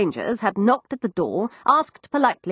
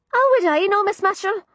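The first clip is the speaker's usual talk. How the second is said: put-on higher, young cockney maid; frightened servant girl, higher cockney; Florrie's voice; 13 semitones higher than usual. Narrator voices East End maid.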